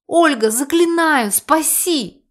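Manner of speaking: high, imploring cry; desperate appeal. A narrator's reading. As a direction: beg to be rescued. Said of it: "пытался воззвать к моей совести".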